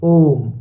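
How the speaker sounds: ओम sustained on the ओ; long-drawn Om. Shown as a demonstration. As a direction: hold ओम for three beats. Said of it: "ओ को हम लंबा खींचते हैं". (ओम का ओ लंबा खींचा हुआ)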